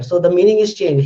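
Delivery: ordinary delivery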